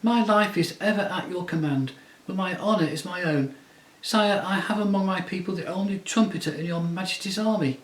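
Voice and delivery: high, courtly little mouse voice; a tiny knight pleading with honour; proud mouse knight. Acted. Reepicheep speaking.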